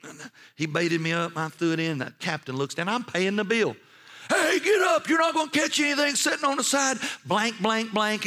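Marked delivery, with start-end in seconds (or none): 4.3-8.2 high, loud mock captain's shout; imitated scolding, pitched way up; speaking strongly. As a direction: yell it like a captain bawling out a passenger. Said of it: "I'm speaking strongly".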